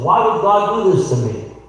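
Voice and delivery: retold anguished question to God; anguished protest. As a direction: plead as a wronged victim, retelling her words. Quotes bombing victim's anguished question.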